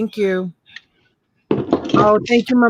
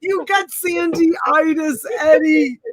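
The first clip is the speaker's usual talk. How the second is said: high, slow laughing diagnosis; laughing tease; 6 semitones higher, slower than usual. Real, not acted. Host teasing Eddie in chat, laughing. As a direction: crack up teasing him.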